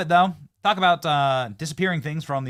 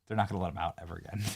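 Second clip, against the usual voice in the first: quick aside; 9 semitones lower, 8 dB quieter, much faster than usual. Real, not acted. Quick first take, then slow repeat.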